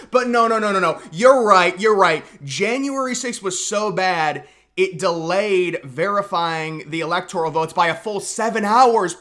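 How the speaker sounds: loud sarcastic mock concession; loud sarcasm. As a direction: ham up agreeing with them, dripping sarcasm. Sarcastic mock agreement with liberals.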